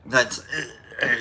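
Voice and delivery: ordinary delivery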